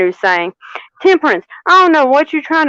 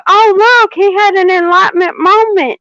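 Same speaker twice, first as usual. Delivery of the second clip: high, mock excited gush, much higher and louder; hammed-up squeal over an enlightenment moment; 9 semitones higher, 3 dB louder than usual. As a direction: play up a gushing reader for a laugh. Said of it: "normally I would go".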